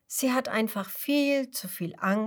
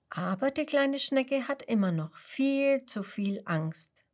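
plain reading voice

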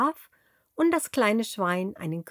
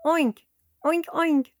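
high squeaky oinks climbing higher; pig oinks; 8 semitones higher, 3 dB louder than usual. Shown as a demonstration. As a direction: oink like a playful piglet. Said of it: "wie ein Schwein macht?".

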